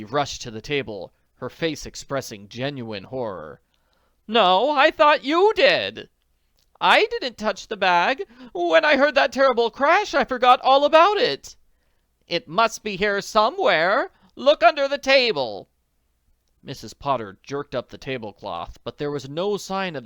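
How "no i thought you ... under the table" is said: high, loud frantic voices; panicked ladies. Acted. Friends searching for bag, frantic.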